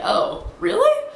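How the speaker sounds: very high, slow, drawn-out "Oh, really?"; surprised disbelief. Retold or imitated. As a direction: act stunned to learn the band's name. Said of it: "I was like".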